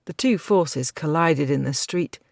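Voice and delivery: usual speaking voice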